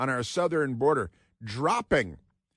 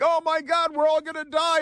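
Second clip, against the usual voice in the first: much higher mock hysterics; mock panic; 3 dB louder than usual. Mocking the yelling crowd in high mock panic. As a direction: shriek it like frightened protesters. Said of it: "And they're like".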